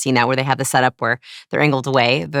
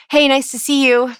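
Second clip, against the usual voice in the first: high, tight nervous hello; compressed greeting; 8 semitones higher than usual. Shown as a demonstration. Demonstrating an anxious first impression, high and compressed. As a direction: squeeze the greeting up high, shoulders hunched. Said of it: "compressed like that".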